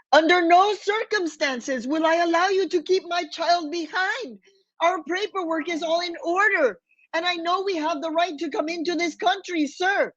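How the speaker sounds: a mother tiger protecting her cub, voice lifted high; mother telling the agent he cannot keep her child, fierce and high; fierce maternal strength. Retold or imitated. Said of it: "inner voice of maternal strength and anguish".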